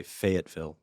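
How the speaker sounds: "Fayetteville" with every syllable distinct, "FAY-et-ville"; enunciated. Real, not acted. Pastor answering how he says it, fully enunciated. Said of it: "I do enunciate".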